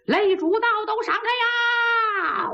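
high, slow, drawn-out street cry; herald clearing the way. Acted. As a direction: stretch it out like a herald.

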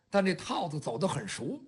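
ordinary delivery

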